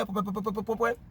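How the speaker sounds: string of quick popping syllables; rapid "po-po-po" mumble. Real, not acted. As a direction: cover the name with po-po-po. Masks a name in the letter. (po-po-po rapide pour masquer le nom)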